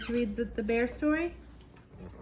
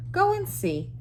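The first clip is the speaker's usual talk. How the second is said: a hint of high Missus Bear voice; 8 semitones higher, 6 dB louder than usual. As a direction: nudge him lightly.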